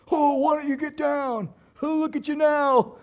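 a hint of a sneering criminal taunting; mocking thief. Thief on the cross mocking Jesus, lightly jeering. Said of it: "they're both mocking him".

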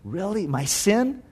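soft, halting disbelief; tentative wonder with questioning lifts; hushed amazement. Acted. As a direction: barely dare to believe it, very quietly. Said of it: "in his heart it was like".

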